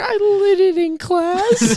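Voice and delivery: put-on very high, slow goofy kid voice; imitating Sergio. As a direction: drag it out, high and silly. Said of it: "And then he was like".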